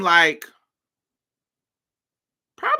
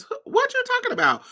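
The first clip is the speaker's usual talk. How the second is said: Mario playing dumb; faster than usual.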